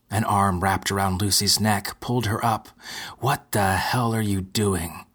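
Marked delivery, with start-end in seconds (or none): none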